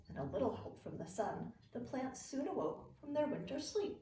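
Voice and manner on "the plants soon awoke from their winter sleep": plain reading voice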